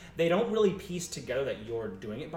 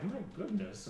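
mildly amazed passer-by; 6 semitones higher, 6 dB quieter than usual. Acted. Passer-by reacting to the chant.

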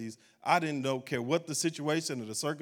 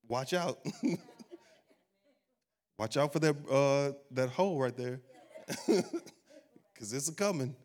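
chuckling through a teasing warning; laughing warning; slower than usual. Acted. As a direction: tease them, laughing.

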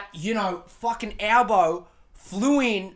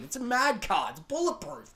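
ordinary talk, nothing marked

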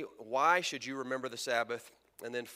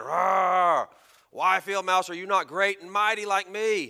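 loud, higher roaring lion; 5 semitones higher, 10 dB louder than usual. Acted. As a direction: roar it out as loud as you can. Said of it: "roars as loud as he can".

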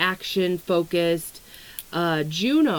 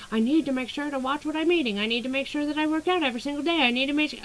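mock nagging; 7 semitones higher, much faster than usual. Mocking diet pressure inner voice.

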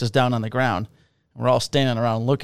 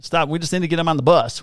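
exasperated firemen; 6 semitones higher than usual. Retold or imitated. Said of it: "they're like".

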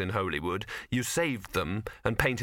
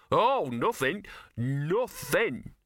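higher, sulky dog; huffy dismissal; 6 semitones higher, much slower than usual. Acted. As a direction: drop the matter in a pointed sulk.

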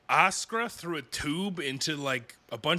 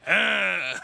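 mock furious shout; 4 semitones higher than usual. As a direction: blurt an outraged cry in mock fury. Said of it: "He's super furious about it".

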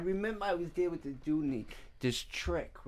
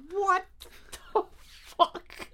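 laughing disbelief; 13 semitones higher, slower than usual. Retold or imitated. Reacting to her strange confession.

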